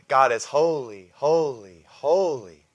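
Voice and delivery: each holy called out strong; ringing declaration, repeated three times; loud proclamation. Real, not acted. Preacher proclaiming holiness to the congregation, loud.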